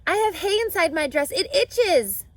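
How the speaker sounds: put-on a girl hollering about itchy hay; Fern's loud, high call. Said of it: "called Fern".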